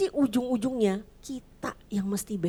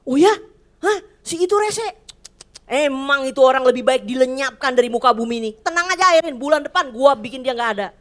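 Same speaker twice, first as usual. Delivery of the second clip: higher, louder, eager tough-guy promise; God as a streetwise buddy; 3 semitones higher, 8 dB louder than usual. Pretend. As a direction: play God as a mate ready to sort them out. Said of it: "Tuhan tuh nggak pernah saya temukan dia begini". (Tuhan dibayangkan seperti teman preman, keras dan bersemangat)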